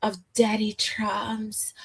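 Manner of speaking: lightly voiced breathy valley girl. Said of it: "why you gotta say it like that".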